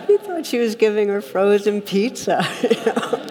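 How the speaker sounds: real amusement breaking into speech; laughing while talking. Real, not acted. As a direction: let the laugh spill over the words. Still laughing at punchline.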